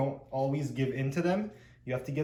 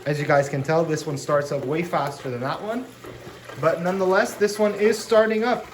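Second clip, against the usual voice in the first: raised voice over loud bubbles; yelling over noise; 3 dB louder than usual. Real, not acted. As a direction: talk loudly over the pump noise. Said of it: "Sorry, I'm yelling over here".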